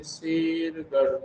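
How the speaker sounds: शेरगढ़ drawn out slowly, every sound clear; slow careful word. Shown as a demonstration. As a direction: say शेरगढ़ slowly so students hear the ढ़. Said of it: "जैसे मैं बात करता हूँ".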